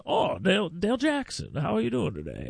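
a hint of a fussy old politician; imitating Jim Ziegler. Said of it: "he talks just like that".

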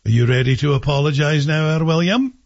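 Scottish parent demanding an apology; Scottish accent. Acted. Parent asks skulking son to apologize, in a Scottish accent. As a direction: question the boy in a Scottish accent. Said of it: "in a Scottish accent".

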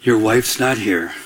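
low, slow, deliberate statement; God's word retold low and unhurried; very clear. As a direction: deliver it clearly as a deep, measured verdict. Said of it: "he said this very clearly to me".

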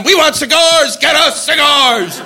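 high chanted yell, drawn out at the end; mock crowd screaming. UN delegates demanding from the floor, mock screaming. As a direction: imitate a rowdy crowd's demand. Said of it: "they were screaming at in the U N".

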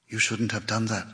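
low, soft, strained woman's voice; hoarse, forced. A narrator's reading. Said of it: "Her voice was hoarse and forced".

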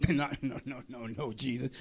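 low, hushed run of no's; retold soft protest; dismissive denial. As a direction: brush it off under your voice, as Peter would. Peter brushes off Jesus' warning.

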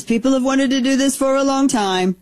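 a hint of Trump reading his own post. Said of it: "I swear you hear his voice".

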